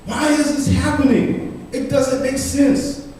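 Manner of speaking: high-pitched protest within a poem; outraged outcry. Acted. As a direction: cry it out as a bewildered, angry citizen. Voicing outraged citizens in poem.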